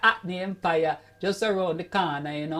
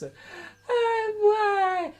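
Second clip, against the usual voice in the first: exasperated cry; 12 semitones higher than usual. Acted. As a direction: cry it out to the crowd. Comic exclamation between jokes.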